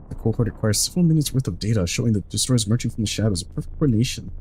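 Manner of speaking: fast, soft read-through of the story text; hurried flavor text read under the breath; quick game text read. Acted. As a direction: rattle off the log quietly and quickly. Reads game text aloud.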